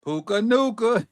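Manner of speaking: slow, high mock singsong; imitating her. Football streamer copying how she says the player's name, playful imitation. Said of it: "That's how she said it".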